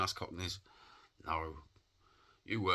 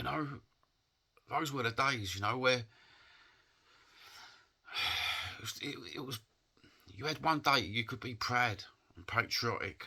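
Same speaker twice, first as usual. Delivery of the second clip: high, halting voice, sighing with real emotion; upset; 5 semitones higher than usual. Real, not acted. Man mourning the old days of pride, upset. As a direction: struggle through a fond, painful memory. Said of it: "it upsets me now".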